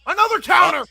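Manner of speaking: excited shout. Real, not acted. Partner's excited reaction to counter.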